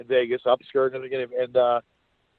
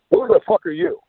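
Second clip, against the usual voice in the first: higher, louder mock demand; a hint of a furious husband; retold angry husband; 13 semitones higher, 5 dB louder than usual. Husband confronting at door.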